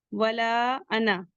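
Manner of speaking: drawn-out recitation of the phrase; slow recitation. Shown as a demonstration. Teacher models the phrase for students, slowly drawn out. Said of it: "recites the phrase".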